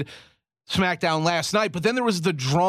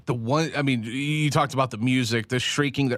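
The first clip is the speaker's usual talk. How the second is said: usual speaking voice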